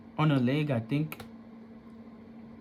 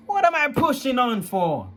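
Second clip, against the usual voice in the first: raised, louder bitter question; angry; 9 semitones higher, 4 dB louder than usual. Real, not acted. Young man venting at family, loud and higher. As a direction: demand it angrily, voice climbing. Angry outburst at grandma's advice.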